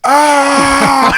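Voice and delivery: high loud imitated yelp; mock pain cry. Friend grabbed by the fresh tattoo, mock pain cry.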